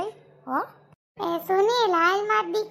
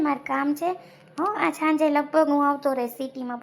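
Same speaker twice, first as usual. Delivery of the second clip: ordinary delivery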